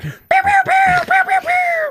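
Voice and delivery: very high, loud zapping pew noises; mock laser pew sounds. Teasing co-host that his brain went blank, mock laser zaps. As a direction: fire off cartoon laser blasts. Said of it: "your brain's just like".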